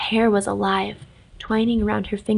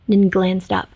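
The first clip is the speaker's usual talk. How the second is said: ordinary delivery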